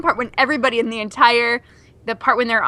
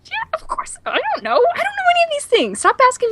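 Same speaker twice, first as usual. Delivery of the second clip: high, flustered protest; flustered squeak. Real, not acted. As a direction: squeak out being put on the spot. Flustered, pressed with questions.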